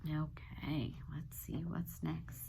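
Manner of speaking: quiet whisper to herself; soft whisper. Real, not acted. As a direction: murmur it under your breath. Teacher turning page quietly.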